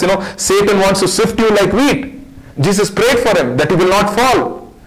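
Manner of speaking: high-pitched, urgent sermon delivery; impassioned preaching. Real, not acted. Preacher assures fallen believers that Jesus prays, impassioned. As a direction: drive each phrase home to the congregation.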